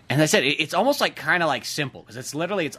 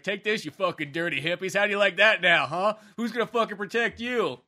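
acted gloating challenge, pitched up; mock taunting; 6 semitones higher than usual. Voicing pit bull haters' payback at dirty hippies, mock taunting. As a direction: taunt them like you're getting payback.

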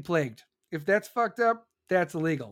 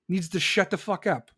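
usual speaking voice

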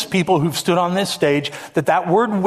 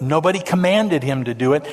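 normal talking voice

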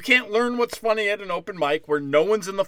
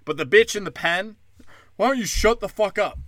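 normal talking voice

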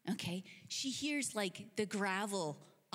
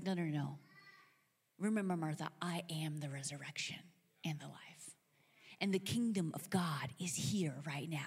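Jesus; 5 semitones lower than usual. Acted. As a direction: correct her gently and solemnly. Jesus gently corrects Martha.

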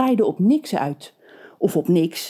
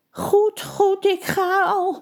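high, slow grumbling witch; 13 semitones higher, much slower than usual. A narrator's reading. The witch grumbling as she gives in to the townsfolk, high and slow. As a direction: grumble it like a beaten old witch. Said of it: "mopperde de vrouw".

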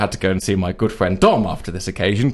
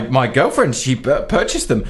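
usual speaking voice

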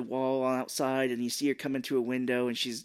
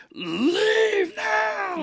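loud, high, strained mock shriek; scream from the gut; 6 dB louder than usual. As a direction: imitate an enraged old woman screaming. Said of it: "from the gut goes".

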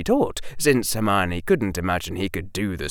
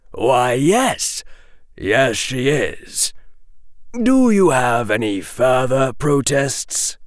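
slow, higher, deliberate professor; thoughtful Quirrell; 4 semitones higher, 3 dB louder, slower than usual. Acted. Professor Quirrell answering Draco's protest, thoughtful. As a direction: muse aloud, stretching the words. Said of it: "tapped a finger on his cheek thoughtfully".

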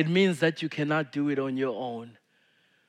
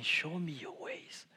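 soft prayer; 14 dB quieter than usual. Shown as a demonstration. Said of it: "the best way ... is saying".

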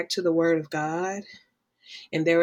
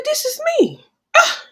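high excited exclaim of recognition; 5 dB louder than usual. Retold or imitated. To herself at the abuse training, a high shocked exclaim. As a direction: cry out the discovery, pitched way up. Realizing she is the abused one.

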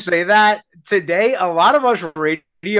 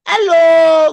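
high, loud cartoon greeting; Mario; 9 semitones higher, 6 dB louder than usual. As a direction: sing out a big cartoon plumber hello. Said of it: "I was going".

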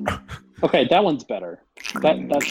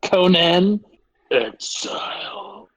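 barbarian impression; 8 semitones higher, much slower than usual. Let loose about a beloved game, mock barbarian. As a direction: bellow the title, then stretch it out. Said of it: "Is that your best barbarian impression?".

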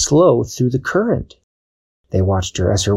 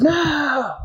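much higher cry of dismay; despairing snake. Acted. Snake watching Dashi fly off, high wail. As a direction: wail as the prize escapes.

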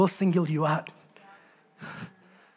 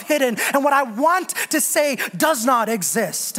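raised, building preaching voice; preaching climax; 7 semitones higher than usual. Acted. Pastor rallying the church, building to a climax. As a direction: build the sermon to its peak.

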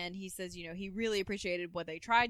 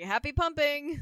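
cheeky send-off; 8 semitones higher, 8 dB louder than usual. Retold or imitated. Julia's send-off quip.

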